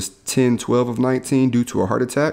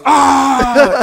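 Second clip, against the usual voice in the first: very high, much louder yell of pain; a comic howl of pain; loud mock scream; 9 dB louder than usual. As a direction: let out the kid's big hammed-up cry. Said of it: "And he goes".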